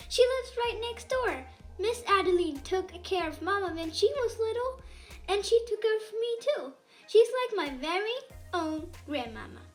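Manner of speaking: plain reading voice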